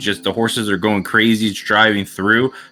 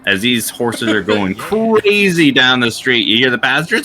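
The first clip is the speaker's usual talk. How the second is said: normal talking voice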